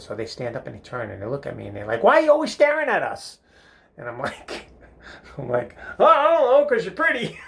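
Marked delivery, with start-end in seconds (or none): 2.0-3.4 put-on high, loud girls calling him out; shrill-pitched accusing quote; mock confrontation. Girls confront him in retold scene. 6.0-7.4 very high, loud panicked reply; hammed-up embarrassed outburst; flustered blurt. Flustered boy blurts out answer.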